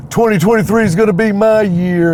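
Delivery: high-pitched, hyped-up resolution; mock optimism. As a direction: imitate someone declaring big hopes, pitched well up. Mimics an optimistic New Year resolution.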